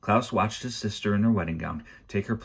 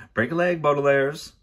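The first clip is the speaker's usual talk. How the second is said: loud, high call; hearty send-off; 8 semitones higher, 6 dB louder than usual. A narrator's reading. Banker leaving for his seat, calling out good luck. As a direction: call it out cheerily.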